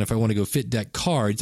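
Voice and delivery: normal talking voice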